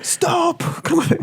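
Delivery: high mock action-hero yell; Arnold Schwarzenegger. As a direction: imitate a movie tough guy crying out. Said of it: "one that was Arnold Schwarzenegger".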